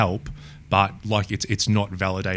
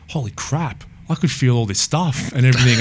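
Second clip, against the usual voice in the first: retelling a stunned, elated man, pitched up and loud; excited discovery; 6 semitones higher, 7 dB louder than usual. Retold or imitated. CEO back after weeks of practice, elated and loud. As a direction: blurt it out like you've just felt something new. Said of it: "he's like".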